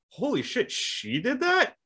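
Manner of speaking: high, slow stunned disbelief; shocked disbelief. Retold or imitated. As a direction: gasp it out in disbelief. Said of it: "I was like".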